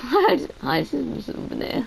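high squeaky what is that; startled, teasing reaction; mock surprise. Real, not acted. Greeting friend with mock shock.

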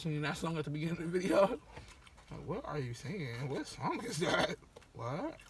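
talking past held chopsticks; chopsticks near lips. Real, not acted. Seen 3: From 2.8s the hand holds chopsticks across his lips.

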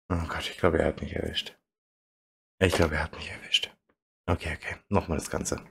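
very soft defeated voice; stunned murmur. Real, not acted. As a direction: mumble it in shock. Stunned after losing. (leise fassungslos)

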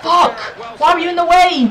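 high, loud complaint; exasperated gamer outburst; frustrated yell. Real, not acted. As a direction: shout at the player blocking you. Berating a blocking player.

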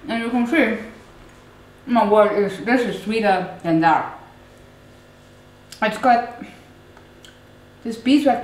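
talking while chewing honeycomb; chewy muffled words between bites; mouth full, chewing. Real, not acted. Seen 2: Bites at -5; jaw and lips keep chewing while she talks.

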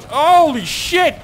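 high, loud, drawn-out exclamation; astonished yell. Real, not acted. Player as the statue comes down, astonished yell. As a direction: yell it out in amazement.

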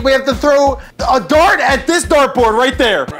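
normal talking voice

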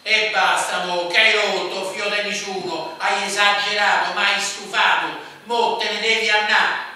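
very loud furious yell; exclaiming. A narrator's reading. Mother screaming at her son to leave, very loud. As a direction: scream him out of the house. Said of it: "esclamò la madre di Sasha".

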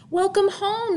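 high, sing-song welcome home; cheery welcome. Acted. Mother greets her daughter home, bright and high.

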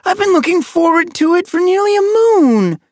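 high, loud, disappointed whine; childlike cry of dismay; wailing. A narrator's reading. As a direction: wail it out like a crushed youngster. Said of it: "voice rose in a wail".

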